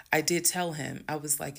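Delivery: ordinary delivery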